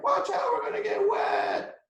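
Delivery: put-on very high shouted kids' voice; kids shouting. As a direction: squeal it like little kids under a tear. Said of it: "They're like".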